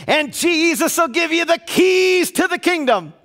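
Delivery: preaching shout. Retold or imitated. Pastor retelling his Montana sermon, shouting it. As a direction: belt it out like a revival preacher in full flight. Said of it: "[name] gets loud here".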